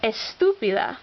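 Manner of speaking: Estúpida with TÚ stressed; stress on accented syllable. Shown as a demonstration. Teacher listing words with written accents, stress on accented syllable. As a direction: lean on the second syllable of Estúpida. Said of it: "words that need written accents".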